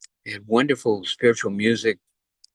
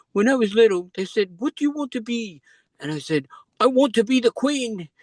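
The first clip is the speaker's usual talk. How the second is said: Elizabeth's voice; 11 semitones higher than usual. Retold or imitated. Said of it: "So she said".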